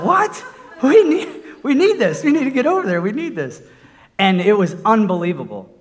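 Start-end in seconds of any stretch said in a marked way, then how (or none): 0.0-3.6 high, loud retold cry of disbelief; startled protest. Telling the Lord he needs that money, high startled protest. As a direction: blurt it out, caught off guard, then settle.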